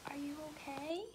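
soft whispered concern; worried whisper. Acted. Pig quietly asking her gloomy friend if he is okay. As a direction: whisper it gently to a sad friend. Piggie checks on sad Gerald.

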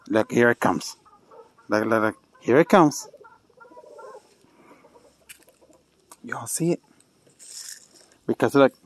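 low soft hushed voice. Real, not acted. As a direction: keep it down so it comes over. Hushed watching animal approach.

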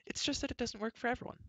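high-pitched, gentle explanation; princess reassuring. A narrator's reading. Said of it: "Elenwy assured him".

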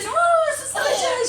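high, loud whoop then a slow cheer; excited whoop. Real, not acted. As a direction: whoop with delight at the splashing. Water fight cheering.

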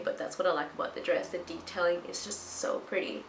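usual speaking voice

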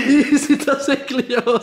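high, slow laughing disgust. Real, not acted. Disgusted at the food.

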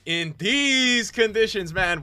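high, slow, loud catchphrase; drawn-out exclamation. Real, not acted. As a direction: stretch it out in amazement. Catchphrase exclaimed after gameplay moment.

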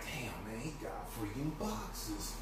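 hushed, slow thinking aloud; quiet inner thought. Retold or imitated. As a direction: mutter it to yourself. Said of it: "I was thinking".

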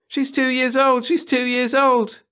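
high, loud, slow jeering repeat; mocking chorus. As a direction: taunt her together. Class mocking, outburst of laughter.

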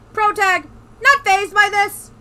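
high, loud sarcastic aside; mock outrage, loud; loud mocking commentary. Real, not acted. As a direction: ridicule the character's calm. Mocking own character loudly.

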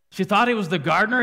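loud high fast She thought he was the gardener; exclaimed. Real, not acted. Preacher marvelling at congregation, loud and fast. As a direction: cry it out in disbelief. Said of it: "preaching repeat".

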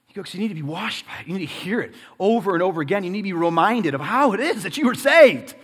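insistent sermon crescendo; preaching, building. Real, not acted. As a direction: start brisk and build to a loud finish.

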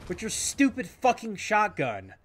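high, loud annoyed complaint; exasperated rant. Real, not acted. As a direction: vent at the enemy who knew. Complaining at enemy player.

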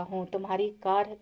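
ordinary delivery

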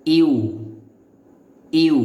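ইউ as one loud gliding syllable; model pronunciation. Shown as a demonstration. Teacher shows learners how to pronounce it, model pronunciation. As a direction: glide from i to u in ইউ, distinctly. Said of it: "এভাবে উচ্চারণ করতে হবে".

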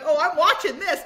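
giddy, can't believe what she's seeing; high, loud laughing excitement; laughing delight. Retold or imitated. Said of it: "And I'm like".

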